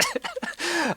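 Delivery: high burst of laughter; real laugh. Real, not acted. Laughing at his own story.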